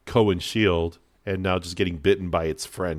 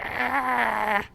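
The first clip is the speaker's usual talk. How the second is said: spider shriek. Acted. Wounded spider screams.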